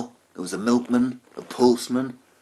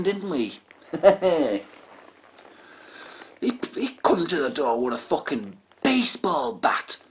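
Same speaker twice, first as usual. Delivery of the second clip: ordinary talk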